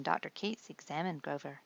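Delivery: ordinary delivery, nothing marked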